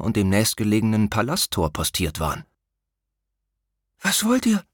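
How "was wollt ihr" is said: put-on much higher, tense frightened boy; a scared prince whispering. A narrator's reading. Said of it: "flüsterte Korion". (ängstliches Flüstern)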